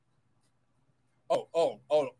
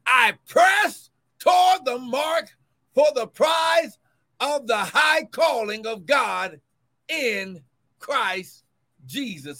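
rising, ringing preacher's reading, slowed on the mark; preaching scripture; 4 semitones higher, 4 dB louder, slower than usual. Acted. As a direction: proclaim the verse to the congregation.

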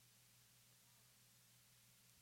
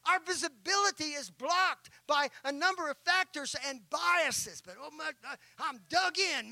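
much louder, higher sermon climax; preaching at full force; 8 semitones higher, 11 dB louder than usual. Acted. Preacher driving home the lesson of stubbornness, full-force preaching. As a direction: preach it hard to the congregation.

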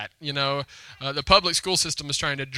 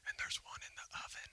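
very soft, hushed whisper; confiding whisper; 24 dB quieter, faster than usual. Real, not acted. Pastor confides his family secret to the congregation, whispering.

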